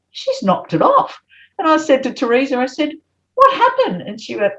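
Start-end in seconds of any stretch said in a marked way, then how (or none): 0.1-1.2 high, slow gasp of dismay; shocked realisation. Retold or imitated. Remembering the empty front yard, shocked and dismayed. 3.3-4.0 high, urgent what happened; retold alarm at a colleague; alarmed question. Asking Teresa about the missing whiteboard, alarmed.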